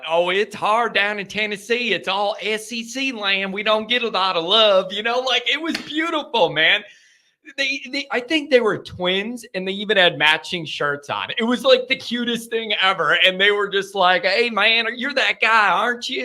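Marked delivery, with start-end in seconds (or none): 0.1-4.9 slightly raised imitation Southern accent. Tennessee fans lamenting life in rival country, Southern accent. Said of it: "the only Southern accent I can do". 14.2-16.2 higher imitation Southern accent; hammed-up Tennessee fan accent. Twin fans recognising the host, imitated Southern accent. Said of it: "the only Southern accent I can do".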